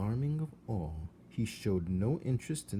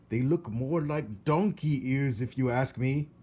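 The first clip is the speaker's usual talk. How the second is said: louder gossiping uncle voice; Uncle Alfred; 4 semitones higher, 5 dB louder than usual. Acted. Uncle remarking on ears, loud gossipy voice. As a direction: chew over the nephew's ears. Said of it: "his Uncle Alfred".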